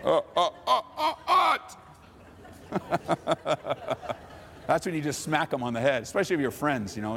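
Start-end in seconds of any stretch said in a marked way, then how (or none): 0.0-4.1 mocking taunt then laughing; sing-song taunt, then laugh it off. Preacher mimics a taunting scoffer, then laughs.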